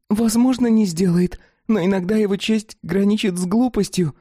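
high, wary creature voice; doubtful dog-woman voice. Acted. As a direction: voice her doubt in character. Fatida answering, doubtful.

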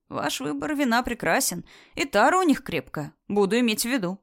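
plain reading voice

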